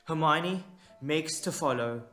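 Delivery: usual speaking voice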